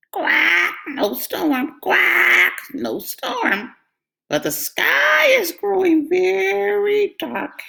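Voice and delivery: very high quacking report; duck lookout. A narrator's reading. Duck reports from the window.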